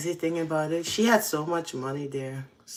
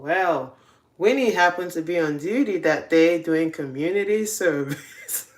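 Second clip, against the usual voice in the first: ordinary talk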